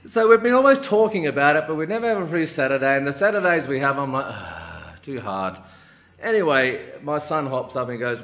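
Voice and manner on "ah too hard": low, quiet sigh of giving up; weary reluctance. Retold or imitated. Father facing hosting on Saturdays, wearily reluctant. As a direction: sigh it off as too much effort.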